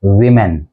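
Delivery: low, careful वीमेन with "wi"; correct pronunciation. Shown as a demonstration. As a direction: start वीमेन with an "ih" vowel. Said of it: "जिसे बोला जाता है".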